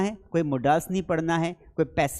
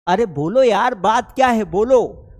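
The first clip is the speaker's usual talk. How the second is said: impatient urging; 2 semitones higher, 7 dB louder than usual. Acted. Teacher acts urging someone to speak.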